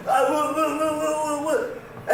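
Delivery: softly stuttered false starts. Retold or imitated. Imitating brother who can't get words out, stuttering. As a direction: get stuck on the first sound. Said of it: "would always stutter".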